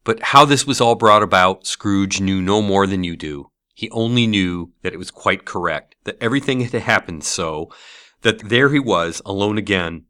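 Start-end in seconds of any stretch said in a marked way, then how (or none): none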